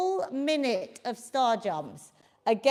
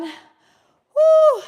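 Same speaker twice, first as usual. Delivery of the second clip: excited whoop; 12 semitones higher, 10 dB louder than usual. Real, not acted. Finishing minute of star jumps.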